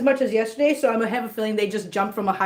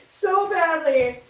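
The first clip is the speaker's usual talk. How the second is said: higher, drawn-out "so badly"; milk it slowly, amused; mock emphasis; 5 semitones higher, much slower than usual. Real, not acted. Owner repeating her joke about the injury, slow and amused. Sarcastic repeat after laughing.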